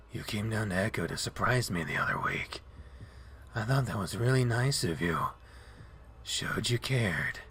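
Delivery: lightly voiced drowsy wolf; dazed wolf voice. Leo's voice, dazed and low.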